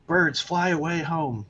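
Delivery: high-pitched mock chant; lightly hammed-up picket-line shout; mock protest shout. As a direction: call out a protest slogan in a high voice. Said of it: "shouting, like".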